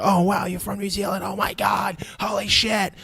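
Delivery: high squealing imitation of an overexcited fan; hammed-up excitement. American meeting a New Zealander, mock ecstatic squealing. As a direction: gush in a put-on high voice, beside yourself.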